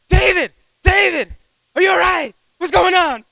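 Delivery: high, loud worried shouting of a name; retold yelling. Pastor shouting over the phone at the crashing driver. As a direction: yell down the phone in alarm. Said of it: "all I can hear is yelling".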